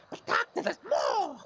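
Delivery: very high, loud, cracking plea; high loud wail of a needy patient; desperate patient voice. Acted. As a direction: squeal it like a frantic little man. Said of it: "Mister Pavlovich interrupts him and he goes".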